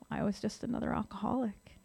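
breathy whisper, no voice. Real, not acted. Speaker to meeting, confessing in a whisper. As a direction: whisper the admission softly. Quiet admission in share.

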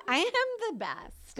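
high, drawn-out self-glorifying boast; mock boasting. Mocking her own drunken delusion, high cocky boast.

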